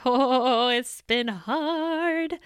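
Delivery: high, slow laugh breaking through. Real, not acted. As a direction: smile you can hear in it. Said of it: "I don't know if you can hear the smile in my voice".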